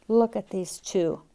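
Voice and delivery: ordinary delivery, nothing marked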